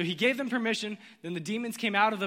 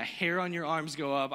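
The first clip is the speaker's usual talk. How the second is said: usual speaking voice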